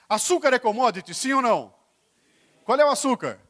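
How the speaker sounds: loud, high, punchy quiz question; improved question cue. Shown as a demonstration. Speaker retrying an improved question on the audience, loud and insistent. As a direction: call it out forcefully to get the crowd answering. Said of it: "eu vou melhorar a pergunta".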